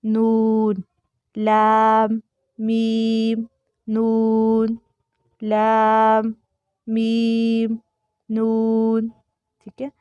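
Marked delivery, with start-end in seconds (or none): 0.0-0.7 نون without nasal sound; clear noon. Shown as a demonstration. Teacher giving the correct نون. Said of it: "یہ نہیں جانی چاہیے". 1.4-9.1 لام، میم، نون said clearly, not nasal; correct letter names. Shown as a demonstration. Teacher recapping the correct لام، میم، نون. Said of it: "یہ صحیح pronunciation ہے".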